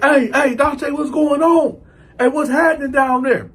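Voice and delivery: high, loud holler to someone down the tier; calling out. Retold or imitated. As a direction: imitate an inmate shouting over.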